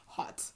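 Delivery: "Hot" begun with a W sound, softly. Shown as a demonstration. Host savoring a nickname, "Hot" said softly with a W. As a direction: put a soft W at the front of "Hot". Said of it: "with a W".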